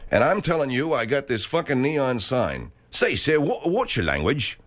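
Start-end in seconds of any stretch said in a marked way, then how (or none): 2.9-4.6 put-on English accent. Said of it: "that accent".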